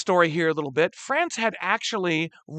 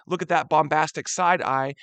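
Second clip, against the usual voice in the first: ordinary talk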